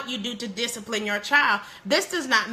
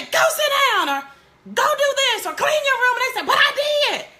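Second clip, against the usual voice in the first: mock bossy parent; 11 semitones higher than usual. Mother voicing parent orders, teen reply.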